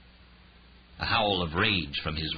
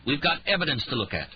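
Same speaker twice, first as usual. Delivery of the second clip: snapped; 7 semitones higher than usual. Acted. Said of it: "she snapped".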